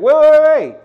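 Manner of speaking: high, loud mock heckle; calling someone back. System stopping someone over an old record, calling out loudly. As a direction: holler it out, stretched and insistent.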